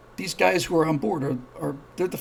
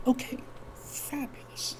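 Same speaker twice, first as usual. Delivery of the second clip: tickled aside; 10 semitones higher, 9 dB quieter, slower than usual. Real, not acted.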